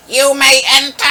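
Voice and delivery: high, loud goblin advisor call. Acted. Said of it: "call out to you".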